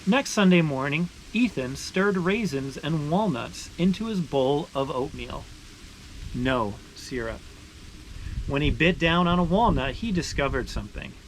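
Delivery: steady, expressive read-aloud voice; story narration. Acted. Reading a maple sugaring picture book aloud to viewers, storytelling voice. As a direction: tell it like a picture book for kids.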